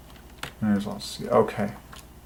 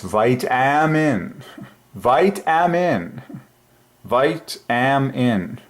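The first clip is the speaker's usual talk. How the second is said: "vitamin" drawn out very slowly, pitched high, leaning on a middle "am"; accent on the center; 4 semitones higher, much slower than usual. Shown as a demonstration. Showing the I am inside the word, "vitamin" stretched with accent on the center. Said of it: "with more accent on the center".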